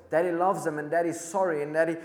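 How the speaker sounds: put-on a dying soldier cries his last message to his girls; desperate, breaking plea; crying out. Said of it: "this soldier is crying out".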